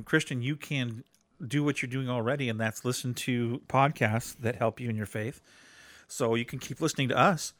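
ordinary talk, nothing marked

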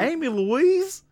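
high, slow teasing call; sing-song scolding name call; drawn-out name call. Acted.

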